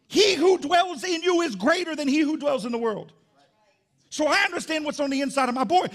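high, loud pulpit declaration; forceful preaching. Real, not acted. Preacher declaring scripture over his son, forceful preaching.

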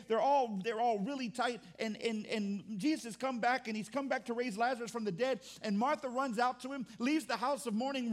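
normal talking voice

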